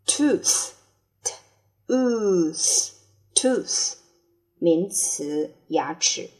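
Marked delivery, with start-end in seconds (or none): 0.1-4.0 very slow, drawn-out model reading; slow drill. Shown as a demonstration. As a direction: drag out each word for learners. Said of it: "teacher drills word slowly". (极慢地示范朗读)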